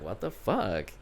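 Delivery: low, hushed disbelief; softly puzzled, under your breath; quiet bafflement. Retold or imitated. Puzzled by Zoe moving her foot away, quiet bafflement. First puzzlement in retold footsie story.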